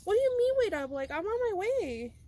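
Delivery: high, loud mock disbelief; retold incredulous protest. Retelling her alarmed reaction to text.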